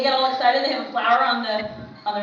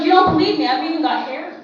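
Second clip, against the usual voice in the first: insistent; 4 semitones higher, 7 dB louder than usual. Retold or imitated. Brother insists on proof.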